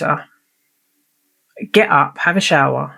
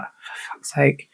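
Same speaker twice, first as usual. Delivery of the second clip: slow, much softer whispered sigh of despair; weary whispered exasperation; exasperated under breath; 7 dB quieter, slower than usual. Retold or imitated. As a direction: mutter it to yourself, fed up. Mum exasperated at her daughter.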